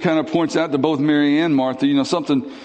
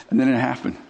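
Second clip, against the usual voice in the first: ordinary delivery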